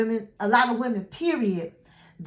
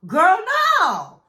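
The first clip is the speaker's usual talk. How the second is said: put-on incredulous girl no; 8 semitones higher, 6 dB louder than usual. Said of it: "I'm sitting in my bed like".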